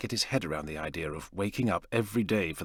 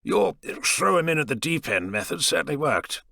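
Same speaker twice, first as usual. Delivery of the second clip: higher voiced patient, lightly acted; a hint of a brash airman; Dan's voice; 8 semitones higher, 3 dB louder than usual. Dan's voice in the story.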